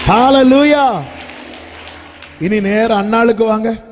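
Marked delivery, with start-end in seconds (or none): 0.1-1.0 loud, high hallelujah cry; joyful preacher's shout. Real, not acted. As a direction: lift the room with a hallelujah.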